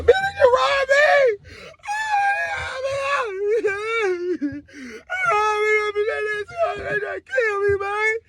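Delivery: high, drawn-out sobbing howl, hammed-up; exaggerated bawling scream; wailing to uncle. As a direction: cry out like a robbed kid. Wails to uncle after robbery.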